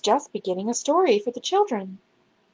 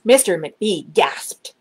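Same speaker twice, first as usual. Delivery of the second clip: usual speaking voice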